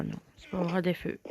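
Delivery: ordinary delivery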